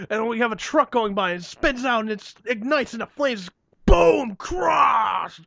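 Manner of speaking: building from eager to a shouted boom and crash; mock pitch rising to a yell on boom; hammed-up Michael Bay. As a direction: pitch it like an overexcited action director, exploding at the end. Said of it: "So Michael Bay's like".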